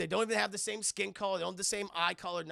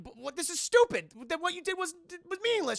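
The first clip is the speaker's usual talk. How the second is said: high, stammering exasperation; mock angry protest; 11 semitones higher, 6 dB louder than usual. Jax confronts Sonya about the rules, high mock-exasperated protest. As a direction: protest in raised, incredulous pitch. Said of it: "I'm just fucking mad".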